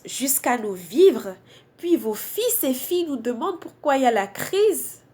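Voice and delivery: emphatic slam delivery in short phrases with pauses; spoken-word poem. Acted. Poet addressing those behind racism, emphatic spoken-word recitation. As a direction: recite a protest poem, leaning hard on key words.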